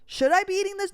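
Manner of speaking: high, loud worried gasp of "Oh"; mock anxiety. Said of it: "seeing that gives me anxiety".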